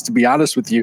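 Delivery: ordinary talk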